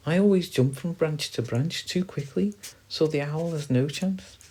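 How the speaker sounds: high squirrel voice; confident squirrel. Acted. Squirrel explaining how it escapes owls, high and confident.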